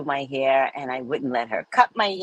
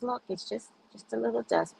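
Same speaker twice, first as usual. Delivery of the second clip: soft coaxing; 7 semitones higher, 10 dB quieter than usual. Retold or imitated. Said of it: "She goes".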